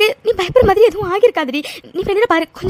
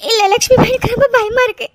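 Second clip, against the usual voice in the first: sobbing confession of fear; frightened crying. Acted. Mithu tells Lakshmi she is scared, frightened crying. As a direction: speak through the sobs. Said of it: "அழாதடா".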